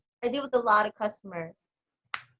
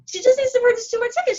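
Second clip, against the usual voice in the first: very high, fast, put-on voice; mock assistant; 12 semitones higher, 5 dB louder, faster than usual. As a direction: rattle it off as the breezy assistant.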